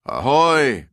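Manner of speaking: loud, high ship's hail; calling out. A narrator's reading. Unseen ship hails stranded men, calling out loudly. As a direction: hail a boat through the fog. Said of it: "called the invisible voice".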